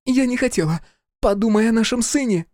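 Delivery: high-pitched, pleading woman's voice; pleading wife. A narrator's reading. Wife on her knees to her husband, pleading.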